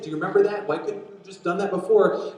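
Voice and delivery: loud, high imitation of a desperate father; acted-out urgent protest; exasperated pleading. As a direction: plead and complain as a worried dad. Voicing Jairus pleading with Jesus.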